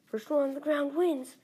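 high loud shout. Acted. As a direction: yell it like a race challenge. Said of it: "shouts Josh".

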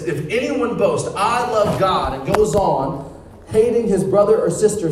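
loud, high scripture proclamation, stretched on key phrases; forceful preaching. Real, not acted. Preacher declaring First John to the crowd, forceful. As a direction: hammer the verse out to the congregation.

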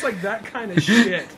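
ordinary talk

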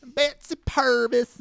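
very high, slow Southern store lady; exaggerated Southern accent. Store lady recognizing [name], hammed-up Southern accent. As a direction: ham up a high-pitched Southern drawl. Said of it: "You don't sound like that".

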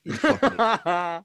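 words squeezed out through laughter; laughing disbelief. Retold or imitated.

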